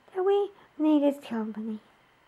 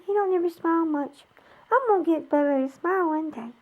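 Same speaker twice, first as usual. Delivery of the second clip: usual speaking voice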